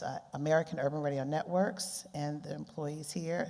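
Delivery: normal talking voice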